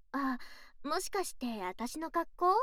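ordinary talk

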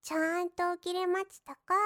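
ordinary delivery

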